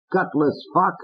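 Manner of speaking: Fats. Acted. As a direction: sneer it.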